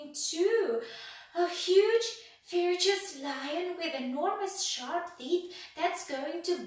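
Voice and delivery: high, loud, slow hammed-up terror; escalating panic. A narrator's reading. Mister Jelly imagines a huge lion, high slow panic. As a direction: pile on the panic, wailing each phrase.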